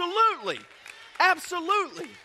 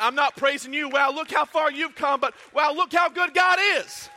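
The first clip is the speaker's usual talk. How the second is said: shouted praise; 3 semitones higher, 7 dB louder than usual. Retold or imitated. People praising God.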